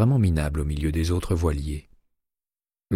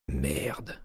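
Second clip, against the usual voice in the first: juron pensé tout bas; 2 semitones lower, 9 dB quieter than usual. A narrator's reading. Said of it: "pensa-t-il".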